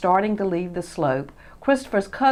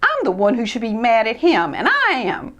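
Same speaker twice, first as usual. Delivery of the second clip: higher, louder aggrieved; indignant; 8 semitones higher, 4 dB louder than usual. Acted. Christopher venting at Ted to Freddie. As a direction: vent the grievance. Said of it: "in disgust".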